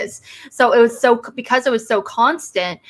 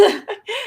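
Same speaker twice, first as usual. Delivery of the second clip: laugh; 12 semitones higher, 6 dB quieter than usual. Real, not acted. Laughing at Cage's vampire act.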